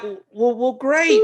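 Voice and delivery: high-pitched, uncertain cheer; hesitant, then much too high; awkward enthusiasm. Retold or imitated. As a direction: fake your way through an agreeable reply. Retelling his clueless, awkward reply.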